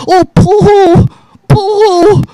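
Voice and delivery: high, babyish mock whimper of poo-hoo. Host mocking the wrestler's sympathy plea in a babyish mock whimper. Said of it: "try to fuck".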